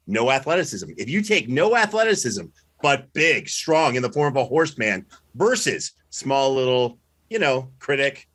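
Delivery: ordinary delivery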